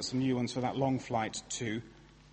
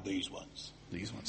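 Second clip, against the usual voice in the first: low, soft growl of these ones; 7 semitones lower, 13 dB quieter than usual. Retold or imitated. The shop assistant growling at the preacher, low and soft. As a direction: growl it like a sulky shopkeeper. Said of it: "he growled at me".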